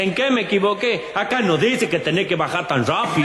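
high, aggrieved reply from the ground; high mock indignation; indignant protest.

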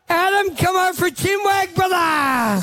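high, carrying call to a passer-by. Real, not acted. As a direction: holler a friendly invitation across the showground. Calling out to a passer-by.

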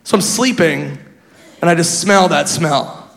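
ordinary talk

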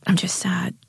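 breathy, voiceless confession of sadness; sad whisper. A narrator's reading. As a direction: whisper it as if it hurts to admit. Jade finally admits sadness, whispered.

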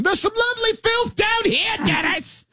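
high, yelled Monty Python peasant; hammed-up Monty Python peasant yell. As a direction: bellow it like a Python peasant in the mud. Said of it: "sound like you're yelling".

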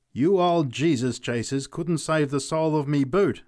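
put-on drunk's defiant shout; scornful cry. A narrator's reading. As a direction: jeer at the preachers. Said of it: "he cried".

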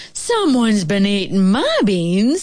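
slow, middle-sized bear voice; drawn-out, mild-mannered motherly bear; mild-mannered mama bear. A narrator's reading. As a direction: complain mildly as a mama bear. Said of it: "mild-mannered middle-sized voice".

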